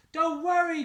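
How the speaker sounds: high loud call; crying out. Acted. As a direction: call out to reassure. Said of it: "cries Stickman".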